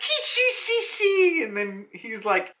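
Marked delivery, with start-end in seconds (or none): 0.0-1.4 high, drawn-out hissy villain laugh; mock cackle. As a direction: stretch out a high "shi-shi" snicker. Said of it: "and he's like".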